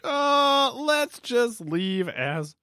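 high, slow, drawn-out mimicry; mock couples' voice. Host repeating how couples decide to stay friends, mock couples' voice. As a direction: stretch it out in a mocking high voice.

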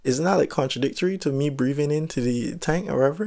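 high, puzzled questioning; confused disbelief. Retold or imitated. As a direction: sound baffled by the idea. Confused about equalizing, retold.

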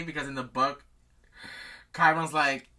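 usual speaking voice

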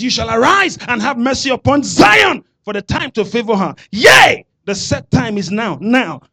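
loud, high declaration; shouted prophetic declaration; preach. Acted. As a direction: proclaim it over the congregation.

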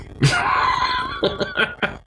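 long, very high squealing laugh; high peal of real laughter. Real, not acted. Cracking up after John's speech to Vince, laughing.

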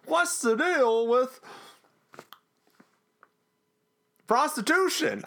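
high, slow stand-up bit; hammed-up observational comic, stretched out; Seinfeld impression. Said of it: "felt like Seinfeld was gonna".

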